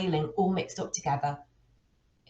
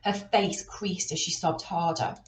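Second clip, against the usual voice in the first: usual speaking voice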